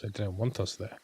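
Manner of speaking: usual speaking voice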